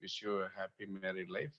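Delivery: a hushed, thoughtful trial of the greeting; quiet musing. Shown as a demonstration. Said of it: "whether I have to say".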